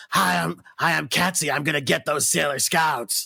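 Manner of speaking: hammed-up gravelly smoker villain voice; Katzy. Joking impression of a dubbed villain, raspy cigarette voice. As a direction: growl it like a pack-a-day smoker. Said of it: "that's kinda what she sounds like".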